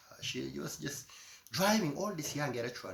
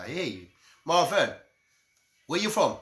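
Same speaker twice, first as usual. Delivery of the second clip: imitated foreigner greeting; 5 semitones higher, 10 dB louder than usual. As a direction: call out like a curious outsider. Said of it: "They wouldn't say that".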